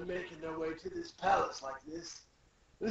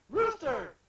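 high, loud acted call; 10 semitones higher, 6 dB louder than usual. Robin calling to Rooster, acted alarmed call. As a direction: exclaim the name in alarm.

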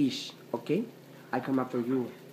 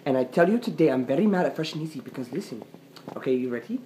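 ordinary delivery

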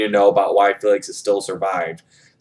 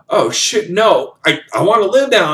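desperate plea; 10 semitones higher than usual. Retold or imitated. Character's last desperate realization.

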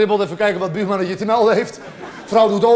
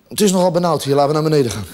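much lower, subdued saint's voice; pillar saint; 7 semitones lower, faster than usual. Acted. Pillar saint to the prisoner up top, low deadpan. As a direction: drop low for a deadpan complaint. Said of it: "de pilaarheilige en die zegt".

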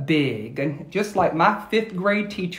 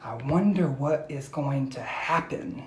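usual speaking voice

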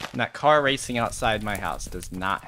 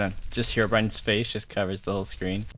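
ordinary delivery

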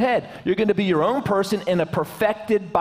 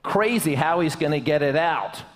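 ordinary talk

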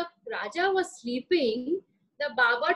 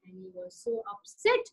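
ordinary delivery

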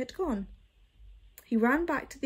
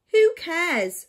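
dismissive; 6 semitones higher, 9 dB louder than usual. Acted. Bird dismissive retort.

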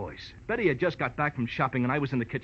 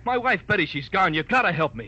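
frantic plea; 5 semitones higher, 5 dB louder than usual. Acted. Frantic husband pleading on phone.

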